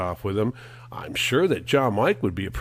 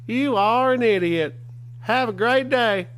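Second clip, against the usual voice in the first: high mocking voice; 11 semitones higher than usual. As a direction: squeak it like a silly troll. Mocking troll's sign-off.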